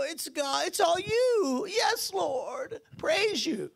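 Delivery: high, tearful, hammed-up piety; mock pious whine. Believers handing everything to God, mock tearful pleading. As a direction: overdo the helpless worshipper. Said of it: "abdicate responsibility and go".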